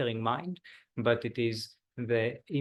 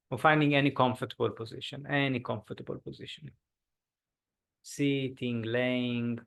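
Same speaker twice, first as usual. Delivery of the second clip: guided meditation. Acted. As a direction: ease the group into stillness. Leads a body scan meditation.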